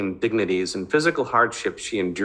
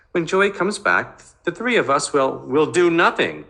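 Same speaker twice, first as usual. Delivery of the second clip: higher, pleading mother's voice; coaxing mother; 8 semitones higher than usual. Acted. Mother soothing her angry son about the birthday, pleading higher. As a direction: coax him gently toward a small celebration.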